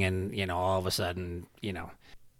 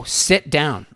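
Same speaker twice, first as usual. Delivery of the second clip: loud, raised DOWN in a firm order; firm command; 5 semitones higher, 6 dB louder than usual. Retold or imitated. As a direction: push "Sit down" out loudly and firmly. Retold firm order to raging man.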